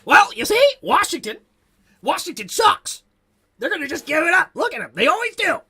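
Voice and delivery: high, loud mocking radio voice; squeaky, hammed-up hater voice; Tom Lavero impression. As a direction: lampoon a smug radio host. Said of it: "What does Tom Lavero say?".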